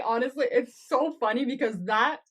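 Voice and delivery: ordinary delivery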